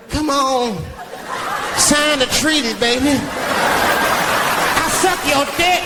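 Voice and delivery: high, breathy come-on to a diplomat; sleazy president. Acted. Comic coked-up president sweet-talking a foreign diplomat, high seductive voice.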